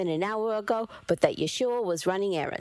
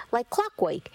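plain reading voice

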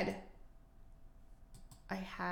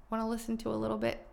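ordinary talk

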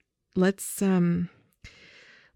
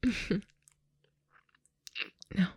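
usual speaking voice

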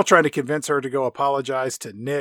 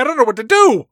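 higher, louder, nearly wailing the repeat; hammed-up wail; 8 semitones higher, 7 dB louder, faster than usual. Dad begging Kat for help, in a hammed-up wail. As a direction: let the panic crack wide open.

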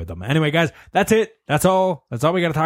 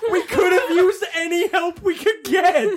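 laughing. Real, not acted. Laughing complaint at friend.

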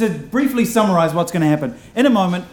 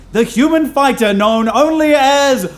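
loud rising introduction; announcer shout; 9 semitones higher, 7 dB louder than usual. Real, not acted. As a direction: build to the name. Host introduces player to crowd.

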